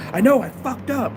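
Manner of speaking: high, loud, exasperated outburst in a friend's voice; imitating angry friend. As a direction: snap it out like a frustrated friend who messed up. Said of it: "Like, all angry".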